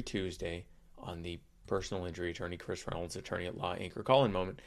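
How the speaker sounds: soft, low rushed sponsor read; hushed announcer. Acted. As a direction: murmur the title under your breath. Same title, now hushed.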